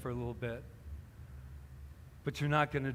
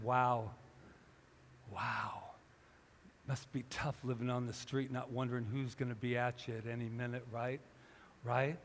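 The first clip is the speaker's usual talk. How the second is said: gentle compassion; 3 semitones lower, 6 dB quieter than usual. Retold or imitated. His compassion speaks to sleeper.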